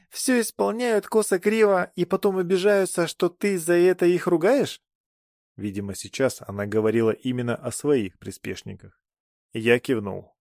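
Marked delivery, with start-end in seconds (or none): 0.1-4.8 put-on high, sneering young goddess; haughty goddess voice. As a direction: play the bored goddess, pitched up. Voicing goddess Astaopa.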